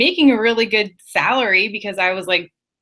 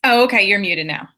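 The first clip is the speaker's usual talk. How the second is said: ordinary talk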